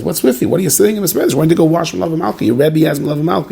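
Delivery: pushy tempter. Retold or imitated. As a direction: goad him like a pest who won't let go.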